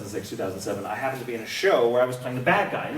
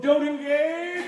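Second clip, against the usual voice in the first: high, slow, laughing "don't engage"; mock-solemn advice; emphatic warning; 13 semitones higher, much slower than usual. Real, not acted. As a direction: drive the warning home. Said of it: "lesson to all of you".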